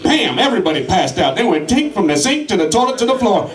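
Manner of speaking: ordinary talk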